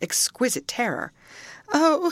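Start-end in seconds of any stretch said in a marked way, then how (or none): 1.7-2.1 high loud sobbing cry; sobbed oh. A narrator's reading. Terrified Linton sobbing to Catherine, high loud cry. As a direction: let a sob break out. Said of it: "he sobbed".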